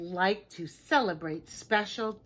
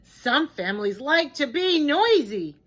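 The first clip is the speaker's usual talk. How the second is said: loud; 5 semitones higher, 10 dB louder than usual. Acted. Reading noisy page loudly.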